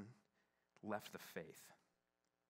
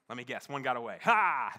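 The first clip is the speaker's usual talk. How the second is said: louder, dry and sarcastic; mock the familiar story with a knowing smirk; snarky aside; 2 semitones higher, 16 dB louder than usual. Speaker mocking the repeated cliffhanger, louder and snarky. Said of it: "It can be a little snarky".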